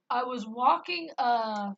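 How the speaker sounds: usual speaking voice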